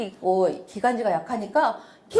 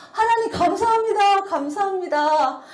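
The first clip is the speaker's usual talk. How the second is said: thankful prayer cry; 7 semitones higher than usual. Retold or imitated. Retelling a prayer after the dust vanished, high grateful cry. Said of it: "그렇게 기도를 했어요".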